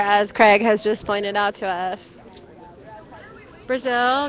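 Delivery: ordinary delivery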